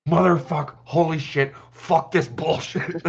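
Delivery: high, loud cursing outburst; angry outburst. Retold or imitated. Daughter finally venting at her dad, loud cursing. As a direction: explode at last, half-laughing. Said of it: "And my daughter goes".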